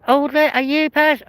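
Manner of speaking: very high squeaky starstruck fan; imitating a fan. Fans on his doorstep, in a very high mock squeal. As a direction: squeal it like an excited fan at the door. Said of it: "turning up on my doorstep and going".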